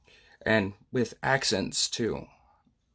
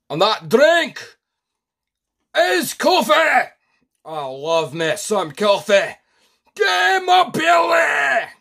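put-on much higher, louder and slow, bellowing in a Scottish accent; rowdy Scottish shout; 13 semitones higher, 8 dB louder, slower than usual. Pub-goer bellowing to his mates about coffee, loud Scottish shout.

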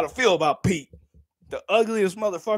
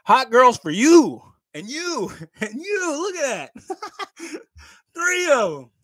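excited; 10 semitones higher, 4 dB louder than usual. Real, not acted. Thanking donors excitedly.

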